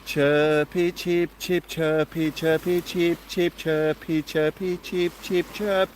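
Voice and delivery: sing-song little chirps; chirpy chirping. Pretend. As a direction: chant it like a cheeping bird. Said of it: "proper chirpy now".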